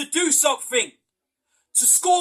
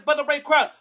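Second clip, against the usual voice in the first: ordinary delivery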